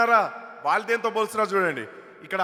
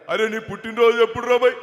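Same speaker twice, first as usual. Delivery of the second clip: loud, familiar politician's question; booming mimicry of a politician; V Hanumantha Rao; 2 semitones higher, 5 dB louder than usual. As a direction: mimic an elder politician quizzing a younger man loudly. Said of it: "Hanumantharao గారి bit ఒకటి".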